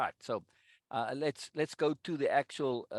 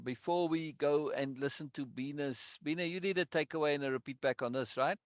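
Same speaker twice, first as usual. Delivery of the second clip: ordinary talk, nothing marked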